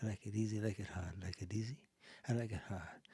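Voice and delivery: barely audible, low repetitive chant; absent-minded murmur. Real, not acted. Cohost forgetting the podcast, murmuring very low as if at home. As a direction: mumble it under your breath in a loop.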